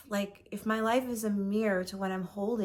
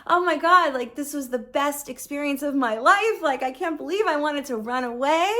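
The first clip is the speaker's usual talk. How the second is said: high and much louder, gushing; overjoyed exclaiming; 5 semitones higher, 7 dB louder than usual. Retold or imitated. Telling viewers her breakthrough felt amazing, overjoyed. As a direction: burst out in amazed joy. Elated realization, quoting herself.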